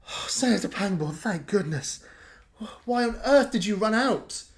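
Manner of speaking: guard fussing over the returned senator; relieved. Acted. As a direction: gush with relief. Thorn greets the senator with relief.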